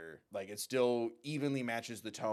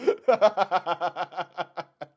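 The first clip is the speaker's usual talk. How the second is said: long, high peal of laughter; helpless loud laughing fit; 5 semitones higher, 9 dB louder than usual. Real, not acted. Amused reaction to the video.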